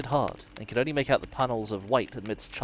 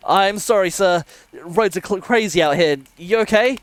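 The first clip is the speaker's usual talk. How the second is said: raised, high, very loud voice; shouting over rain; 9 semitones higher, 12 dB louder than usual. A narrator's reading. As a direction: call out to be heard over the rain. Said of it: "I shouted over the rain".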